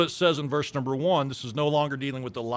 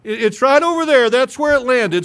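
crying man; 7 semitones higher, 9 dB louder than usual. Acted. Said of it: "Big sissy".

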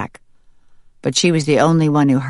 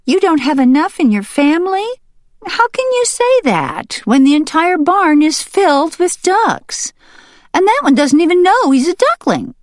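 very high, louder, mocking hen voice; scoffing hen; 5 dB louder than usual. Hen mocking the duck leader, very high and scornful. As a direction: pour scorn on the ducks, high and fast. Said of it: "scoffed the hen".

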